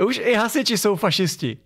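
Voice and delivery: high-pitched mock indignation; laughing into a high, silly complaint; mock protester whine. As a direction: parody an outraged activist. Parodies outraged protesters.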